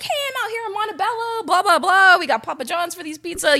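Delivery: hyped club call-in from a party in Montebello; party girl call-in. Shown as a demonstration. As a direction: shout it out like a street-team party girl on air. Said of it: "kinda like that".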